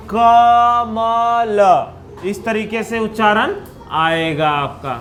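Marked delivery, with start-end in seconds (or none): 0.1-1.6 slow "कमल" with a faint "अ" after each consonant; half-sounded syllables. Shown as a demonstration. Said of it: "ये आधा आधा उच्चारण".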